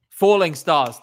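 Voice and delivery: loud, sudden naming of the song; excited recall. Real, not acted. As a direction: blurt the title out as it comes back to you. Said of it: "For some reason, I think of that".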